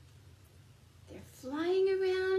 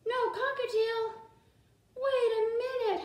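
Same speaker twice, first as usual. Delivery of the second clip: mock dismay; 6 semitones higher than usual. Teacher to class, cockatiel missing, hammed-up dismay. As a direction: play it up for the little ones.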